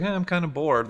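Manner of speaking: high-pitched voice for a dog; put-on bored pet; bored dog's voice. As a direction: voice the dog's idle thought up high.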